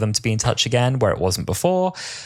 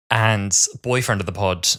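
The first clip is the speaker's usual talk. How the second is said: ordinary talk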